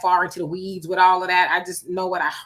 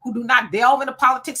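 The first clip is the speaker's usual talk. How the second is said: ordinary delivery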